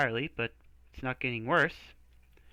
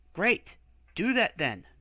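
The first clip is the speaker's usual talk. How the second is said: higher, louder eager push; eager demand; 6 semitones higher, 5 dB louder than usual. Acted. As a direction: jump on the answer. Excited relief, demanding.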